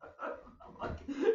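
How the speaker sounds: wheezy unvoiced laugh; real giggle; high breathless laugh. Real, not acted. Laughing after the taunt.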